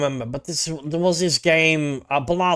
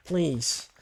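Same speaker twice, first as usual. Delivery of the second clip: ordinary talk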